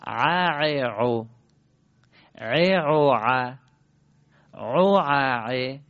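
ع and عو run together three at a time, throaty; joined ayn triplets. Shown as a demonstration. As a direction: chain three thick ayn syllables ع, ع, عو without a break. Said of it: "حالا ستایی پیوسته".

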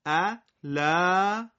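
ا لا read very slowly with long vowels; slow syllable reading. Shown as a demonstration. Teacher reading syllables slowly for the class. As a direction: spell out ا لا slowly. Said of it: "اینجا شد سه حرفی".

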